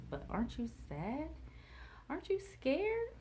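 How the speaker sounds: high, rising disbelieving questions; incredulous questioning. Retold or imitated. Retold worried thought to her.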